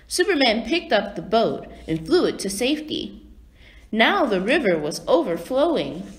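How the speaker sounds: usual speaking voice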